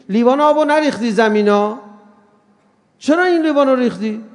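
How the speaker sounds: loud accusing question over a spilled glass; mock accusing. Preacher voicing an accuser over a spilled glass, loud and accusing. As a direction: call him out for the spill.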